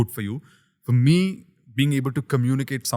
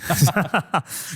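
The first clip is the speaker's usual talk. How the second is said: laugh; 6 semitones higher, 4 dB louder than usual. Real, not acted. Laughing at the accent joke.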